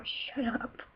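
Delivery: voiceless, whispered comeback; hushed mock retort. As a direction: whisper it off under your breath. Mock retort to teasing friends.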